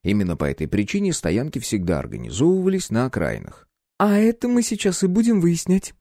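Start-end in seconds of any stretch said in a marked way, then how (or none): 4.0-5.9 high, lively and playful; perky. A narrator's reading. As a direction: say it with zest. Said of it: "задорным голосом ответила Клёпка".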